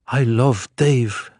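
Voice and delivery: a hint of Tristeza's devout murmur, slowed; Tristeza's quote. A narrator's reading. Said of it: "saying too".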